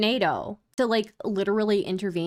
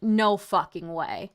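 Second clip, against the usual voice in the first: ordinary talk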